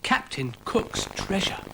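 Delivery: excited whisper. Acted. Florence breathing about the treasure, whispered. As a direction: breathe it in awe. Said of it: "breathed Florence".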